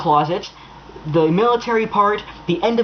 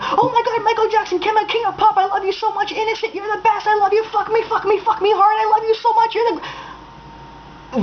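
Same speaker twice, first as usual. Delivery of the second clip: much higher, squealing fangirl gush; mock gushing fan; 14 semitones higher than usual. Mocking obsessed fans who comment on every video, squealing gush. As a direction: ham up a swooning superfan. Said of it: "who comment on every fucking video on YouTube, like".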